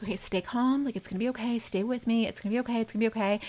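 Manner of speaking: soft, soothing imitation of reassurance; calm voice. Jay keeping Maddie from freaking out, calm and soft. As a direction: keep it hushed and steady, soothing. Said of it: "he's just full on calm voice".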